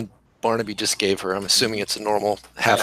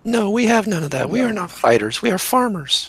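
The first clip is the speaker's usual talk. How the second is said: Celia; 10 semitones higher than usual. Acted. Game master plays farm girl Celia.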